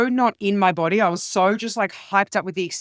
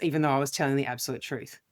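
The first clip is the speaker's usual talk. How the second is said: ordinary talk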